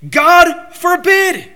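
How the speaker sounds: high, very loud, drawn-out exclamation; emphatic answer. Acted. Preacher quoting Paul's answer to the congregation, hugely emphatic. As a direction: thunder it slowly from the pulpit. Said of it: "the emphatic answer is given".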